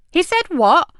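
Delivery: high, loud shocked question; exclaimed. Acted. DI hearing the news on the phone, shocked. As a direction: react in disbelief. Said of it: "she exclaimed".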